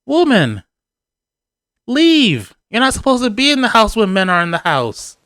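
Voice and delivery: high-pitched mock scolding; indignant command. Husband sending wife out while men visit, indignant command.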